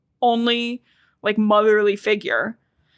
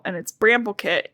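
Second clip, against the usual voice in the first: ordinary talk, nothing marked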